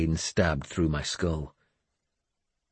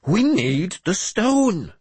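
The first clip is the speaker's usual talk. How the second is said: high, drawn-out mad bishop voice; urgent hiss; 13 semitones higher, 4 dB louder, much slower than usual. A narrator's reading. Mad bishop pleads for the stone, urgently hissing. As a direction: insist slowly in a very high, strained pitch. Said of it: "he hissed".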